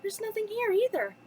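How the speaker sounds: high-pitched storybook dismay; acted high, disappointed exclamation; Belle crying out. A narrator's reading. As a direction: keep the cry up high. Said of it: "she cried".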